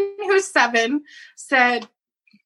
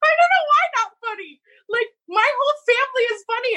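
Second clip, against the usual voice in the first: laughing; 9 semitones higher, faster than usual. Real, not acted. Laughing reply.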